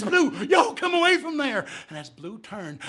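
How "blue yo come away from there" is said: loud, high call to the dog; yelling at the dog. Acted. Jeb calling his dog Blue off the creature, loud high yell. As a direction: holler the dog away from danger.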